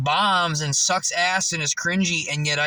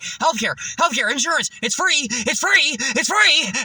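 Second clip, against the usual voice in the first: pushy salesman; 7 semitones higher than usual. Retold or imitated. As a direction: hawk it like a parking lot salesman. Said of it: "had a dude who's like".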